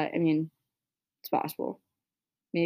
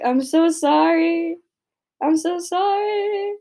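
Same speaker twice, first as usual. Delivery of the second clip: mock apology; 11 semitones higher, 6 dB louder, slower than usual. Apologizing to a friend who listens.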